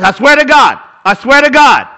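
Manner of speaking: loud insistent swearing, repeated; mock emphatic oath. Preacher mimicking a rash swearer, loud and insistent. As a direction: hammer the oath like someone desperate to be believed. Imitating people rashly swearing oaths.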